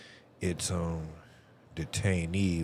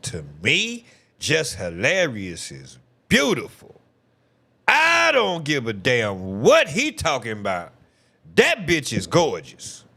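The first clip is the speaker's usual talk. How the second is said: emphatic loud insistence; 7 semitones higher, 7 dB louder than usual. Real, not acted. Loudly insisting on his opinion.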